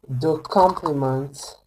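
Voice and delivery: slow, deliberate words for students to copy; teacher dictating slowly; slow dictation. Shown as a demonstration. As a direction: spell out the sentence slowly. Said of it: "building the example sentence".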